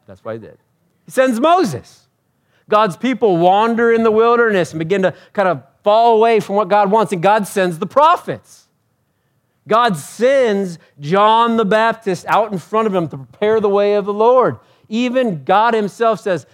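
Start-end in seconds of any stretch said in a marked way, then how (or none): 1.0-2.1 high, loud, drawn-out "He sends Moses"; full-force retake. Real, not acted. Preacher redoing the answer for the congregation, full-force "He sends Moses". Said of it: "I don't know why I said it like that". 2.7-16.5 high, loud pulpit proclamation; impassioned preaching. Acted. Preacher listing whom God sends, impassioned.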